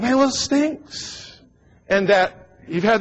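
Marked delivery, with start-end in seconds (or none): 0.0-1.4 slow, high grossed-out groan; disgusted complaint. Retold or imitated. Youth at the all-nighter, grossed out. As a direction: drag it out in disgust. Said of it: "you're going".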